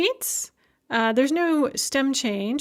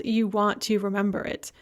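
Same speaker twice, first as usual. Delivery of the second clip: ordinary talk, nothing marked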